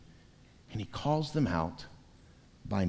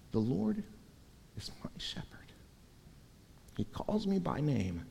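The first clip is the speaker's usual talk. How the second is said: breathy whispered scripture, much softer; hushed whisper; 5 semitones higher, 10 dB quieter than usual. Shown as a demonstration. Preacher quoting the psalm to his congregation in a hush. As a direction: whisper it like a secret prayer. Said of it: "it begins, not".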